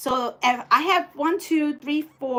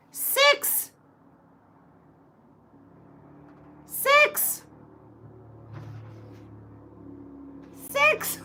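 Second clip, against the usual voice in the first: high, squeaky disbelief on each six; shocked squeal; 13 semitones higher, 5 dB quieter, much slower than usual. Real, not acted. Shocked at being fabric short.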